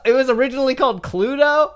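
high, fast disbelief; surprised. Real, not acted. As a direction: gasp it out in shock. Surprised discovery.

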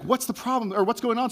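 put-on an old man asking what's happening; puzzled old man. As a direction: ask as a confused bystander. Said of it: "He's like".

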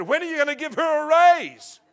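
loud retold demand; public call-out. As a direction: proclaim it to the whole restaurant. Publicly challenging the restaurant boss.

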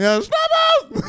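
high, loud, slow granny call; drawn-out call for the cat; dotty old lady calling. Acted.

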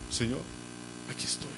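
hushed, private prayer; silent prayer. Acted. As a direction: pray softly, barely audible. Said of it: "silenciosa con el Señor". (oración silenciosa, en voz muy baja)

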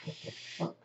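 mock-stunned near-whisper; hushed disbelief. Telling friends how the guard's story stunned him, hushed disbelief. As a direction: mouth it almost without voice, as a retold reaction. Retold stunned private reaction.

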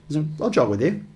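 ordinary delivery

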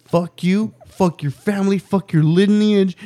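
loud, emphatic imitation of a swearing kid; mock tough confrontation. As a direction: build to a loud final insult. Imitates son's escalated swearing.

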